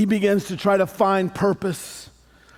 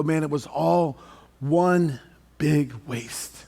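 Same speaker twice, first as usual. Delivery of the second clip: slow, low and softer, one big waste dragged out; regretful lament; 5 semitones lower, 4 dB quieter, slower than usual. Acted. Someone looking back on a wasted party life, slow regretful lament. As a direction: let it sink like a lifetime's regret.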